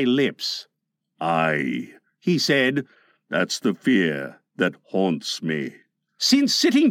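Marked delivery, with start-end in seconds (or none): 1.2-1.9 very low pirate captain; haunted Captain Hook. A narrator's reading. Hook admits his fear to Smee, low and haunted. 3.3-5.7 very low, slow pirate; haunted Captain Hook. A narrator's reading. Hook admits his fear to Smee, low and haunted.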